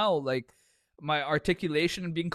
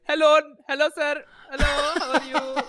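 much higher and louder, a hammed-up caricature accent; silly high put-on voice; 13 semitones higher, 7 dB louder than usual. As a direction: squeak out a cartoonish polite greeting. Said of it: "the voice in my head is like".

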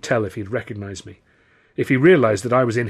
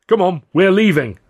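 commanding; 9 semitones higher, 9 dB louder than usual. A narrator's reading. Carno ordering troupe out.

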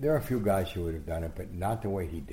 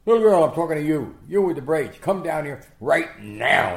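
high, very loud bellowed order; mock commanding shout; 8 semitones higher, 12 dB louder than usual. Boxer ordering reporter's shy daughter over, mock furious shout. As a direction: thunder it out, stretching the last words.

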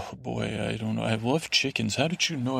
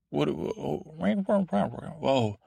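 cat character voice; 3 semitones higher than usual. Pretend. Sir Pounce character again.

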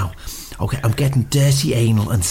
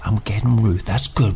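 normal talking voice

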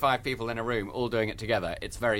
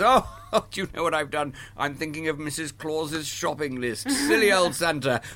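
a hint of a dotty Father Christmas; mock muddled Santa; 4 semitones higher than usual. Shown as a demonstration. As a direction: play a silly befuddled Santa. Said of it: "Father Christmas says".

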